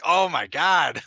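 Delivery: loud nasal imitation of the aunt. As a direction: squeeze it through the nose and loud. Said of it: "You'd be like".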